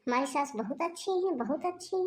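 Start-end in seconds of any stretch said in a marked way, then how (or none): 1.4-2.0 mocking repeat. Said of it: "आप हमेशा हमसे झूठे बोलती थी".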